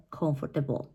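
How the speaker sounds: Comfortable stressed on the first syllable; correct stress. Shown as a demonstration. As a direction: say "Comfortable" as COMF-ter-bul. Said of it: "When you stress the correct syllable".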